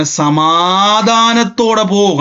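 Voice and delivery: drawn-out, gentle priestly blessing; slow blessing. Retold or imitated. Priest Eli slowly blessing Hannah, drawn out. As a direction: stretch each syllable slowly, like a priest blessing. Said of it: "ഏലിയുടെ വാക്കുകൊണ്ടാണ്".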